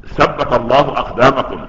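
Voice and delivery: ثبت said slowly with s, "sabbat"; lisped th as s. Acted. Umm Ayman cheers the fighters, s for th in ثبت. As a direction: say ثبت without the tongue out, as "sabbat". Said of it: "لسانها في الثاء".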